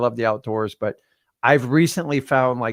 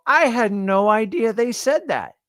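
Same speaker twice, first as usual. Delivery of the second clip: surprised realization; 10 semitones higher, 3 dB louder, slower than usual. Retold or imitated. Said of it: "read the words of a song and go".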